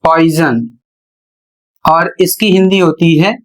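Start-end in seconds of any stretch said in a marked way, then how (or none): 0.0-0.7 पॉइजन said as "poizn", the final o silent; correct English pronunciation. Shown as a demonstration. Teacher spelling the word, then saying पॉइजन as poizn. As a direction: say पॉइजन with no o in the last syllable. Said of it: "P ओ I S ओ N".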